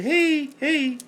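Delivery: put-on high, sing-song little kid greeting; childlike hey. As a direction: model a toddler's hello.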